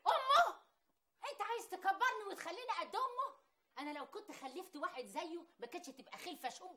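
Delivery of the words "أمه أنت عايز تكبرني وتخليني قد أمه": high, loud shocked squeal; offended shriek at being called his mother's age; outraged disbelief. Acted. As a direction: spit the word back in disbelief. Wife outraged at being called old.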